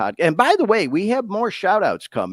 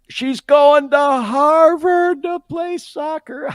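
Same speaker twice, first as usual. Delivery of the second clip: much higher, slower, stretched-out big news; proud excitement; 13 semitones higher, 6 dB louder, slower than usual. Real, not acted. Uncle announces his niece is off to Harvard, thrilled. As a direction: let the pride burst out.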